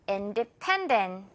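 independent with pen hit hard, very slow; slow, lean on pen; stress on pen. Shown as a demonstration. Teacher modelling the stressed third syllable, slow. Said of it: "자, 듣고 갑니다".

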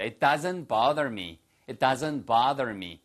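bother said the American way, bah-der with a flapped t-sound; slow American model of bother; slow American-accent demo of bother; American pronunciation. Shown as a demonstration. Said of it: "미국식 발음이고요".

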